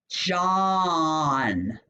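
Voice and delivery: "John" with a long-held "ah" vowel; short O sound. Shown as a demonstration. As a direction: stretch the "ah" in "John". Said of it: "That's the short O sound".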